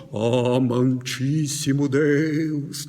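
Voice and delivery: slow, low, solemn intoned prayer; mock prayer voice. A churchgoer changing voice to pray, slow solemn intonation. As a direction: drag it out like a showy pious prayer. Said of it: "ela muda a entonação".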